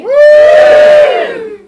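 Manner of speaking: very high, loud woo; loud cheer. Real, not acted. Comedian cheering to lead the audience, loud and high. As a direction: whoop to lead the crowd.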